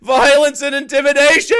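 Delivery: high, loud mocking shout. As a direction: yell it back to mock him. Mocking the video's phrase.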